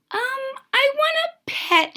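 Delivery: put-on a small witch girl asking shyly; little girl. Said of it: "she said".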